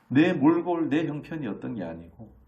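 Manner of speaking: usual speaking voice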